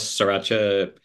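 ordinary talk

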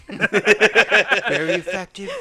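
far-off Trump voice with a laugh; Trump impression. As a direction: brag about tiny things like Trump. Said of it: "That's kinda Trump".

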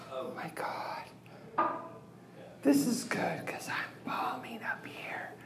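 embarrassed stage whisper. Real, not acted. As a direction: confess it in a whisper. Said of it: "I'm gonna whisper now".

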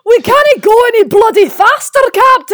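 high, loud aggressive Scottish engineer; Scotty. Acted. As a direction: shout it in a hammed-up Glaswegian accent. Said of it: "just proper aggressive all the time".

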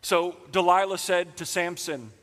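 normal talking voice

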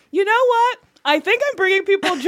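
high, upbeat self-quote; retold cheery declaration. Woman retells her answer to people staring at her outfit, cheery and high. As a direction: pitch it up like a happy announcement.

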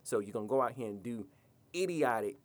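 ordinary talk

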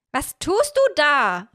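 high, loud, drawn-out shout; startled call. A narrator's reading. Miorin calling from the window to Hiro, loud high shout. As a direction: call it out in disbelief. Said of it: "rief ich".

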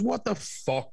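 high astonished outburst; retold disbelief; mock shock. His retold shocked reaction.

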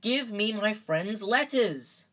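heated, drawn-out order; angry. A narrator's reading. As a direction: demand it angrily, stretching each word. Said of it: "said Harry angrily".

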